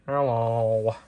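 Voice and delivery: a hint of a sour Squidward. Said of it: "Here's Squidward".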